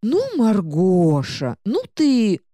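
slow, whining high book voice; whiny talking book. Acted. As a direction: drag out a sulky complaint. Book complains, drawn out.

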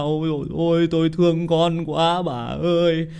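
slow, half-hammed tearful old man; mock old man crying. Old man tells his wife he misses their daughter far away, mock tearful and slow. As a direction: whimper it like an old father missing his girl. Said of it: "Hai ông bà già ngồi ôm nhau khóc".

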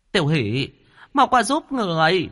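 high, piercing cry for help; shrill loud call. A narrator's reading. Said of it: "nàng the thé giọng kêu to". (giọng the thé kêu to)

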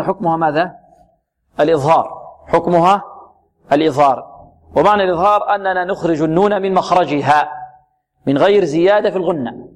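ordinary talk

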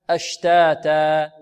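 slow أشتاتا with both long a vowels held evenly; natural madd. Shown as a demonstration. Teacher giving an example, matched long vowels in أشتاتا. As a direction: draw out both alifs in أشتاتا equally. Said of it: "بمد طبيعي".

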